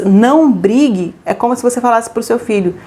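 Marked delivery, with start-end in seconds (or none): 0.0-1.1 very slow, drawn-out "Não brigue"; slow command. Shown as a demonstration. Parent telling the child slowly not to fight. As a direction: spell out the order slowly. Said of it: "quando você diz".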